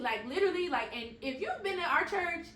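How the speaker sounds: ordinary talk, nothing marked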